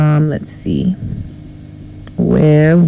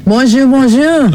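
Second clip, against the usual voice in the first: higher Saint Lucian Creole lilt; Saint Lucian Creole accent; 9 semitones higher than usual. Shown as a demonstration. Co-host greets French-speaking island listeners, Saint Lucian Creole accent. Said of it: "I don't know whatever accent".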